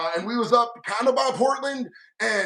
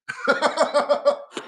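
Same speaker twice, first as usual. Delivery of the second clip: high laugh; 6 semitones higher than usual. Real, not acted. Laughs at the absurd ban.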